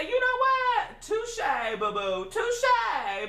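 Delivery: high, sassy retort with swooping pitch; mock-dramatic concession. Conceding to roommate about room for food, sassy and hammed-up. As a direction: concede with a theatrical flourish.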